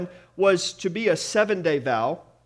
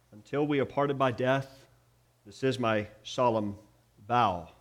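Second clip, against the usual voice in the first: saying wedding vows; 4 semitones lower than usual. Retold or imitated. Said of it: "what I just quoted".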